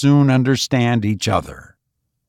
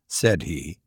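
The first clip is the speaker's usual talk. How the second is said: ordinary delivery, nothing marked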